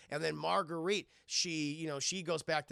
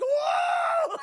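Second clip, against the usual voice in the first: very high, loud cry; hammed-up scared "whoa"; mock shriek; 12 dB louder than usual. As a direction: yelp in exaggerated terror. Sister's shock at demon voice.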